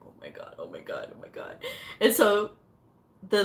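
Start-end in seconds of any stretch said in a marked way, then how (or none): 0.1-1.6 hushed, barely voiced repetition; acted anxious whisper; nervous whispering. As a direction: whisper it over and over to yourself. Retold nervous wait for result.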